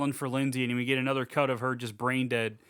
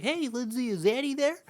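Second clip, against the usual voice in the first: very high, goofy phone-caller voice; mock cheery high-pitched inquiry; put-on caller voice; 11 semitones higher than usual. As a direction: ask in a silly falsetto.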